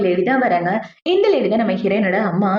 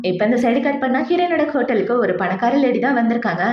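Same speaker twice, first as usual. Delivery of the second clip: ordinary delivery, nothing marked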